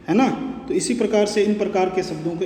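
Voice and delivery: normal talking voice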